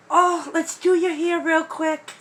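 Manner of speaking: ordinary talk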